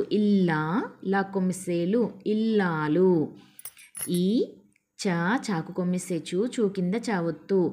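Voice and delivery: usual speaking voice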